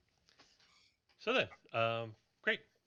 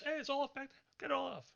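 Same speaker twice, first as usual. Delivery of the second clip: yelling; 3 dB quieter than usual. Acted. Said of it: "somebody else yells".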